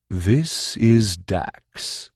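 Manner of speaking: very slow, grave introduction; solemn. Acted. Tough introducing his kitten to Kay, solemn and slow. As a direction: present him like royalty. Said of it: "he said solemnly".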